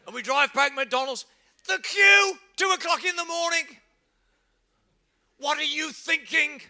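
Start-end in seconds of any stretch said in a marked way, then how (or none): 1.7-6.6 much higher and louder disbelief; incredulous. Real, not acted. Preacher marvelling at the night queue, incredulous. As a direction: marvel at the absurd hour.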